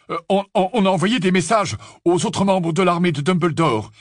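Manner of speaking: stammer, then explain eagerly; hesitant young Fred. Acted. Fred explaining the messages to Harry, hesitant then eager.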